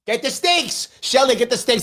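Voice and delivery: high, loud mock-urgent call-out; mock mob call. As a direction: call to a helper like a witch-hunter. Mock call for a witch burning.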